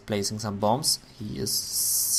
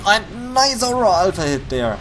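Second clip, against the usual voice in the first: higher, louder excited praise; 8 semitones higher, 7 dB louder than usual. Real, not acted. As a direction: cheer the hit. Caster praising a hit.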